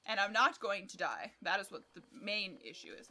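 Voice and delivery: ordinary talk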